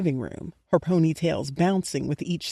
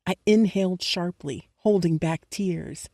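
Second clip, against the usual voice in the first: usual speaking voice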